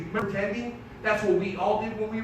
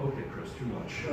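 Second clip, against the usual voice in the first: hushed, low near-whisper; soft mock discomfort; timid objection; 7 semitones lower, 9 dB quieter than usual. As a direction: back off quietly, as an uneasy listener.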